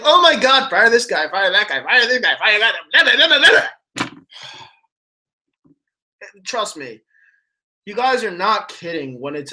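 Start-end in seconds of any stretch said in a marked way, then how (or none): 0.0-4.1 higher, louder hammed-up fan meltdown chanting the firings; mock fan panic. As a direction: mimic a panicky fan, ending in a laugh. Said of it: "it's like".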